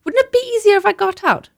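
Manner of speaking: put-on high, fretful child's voice; a small girl sure she's itching; whining little girl. Child pleading with mother.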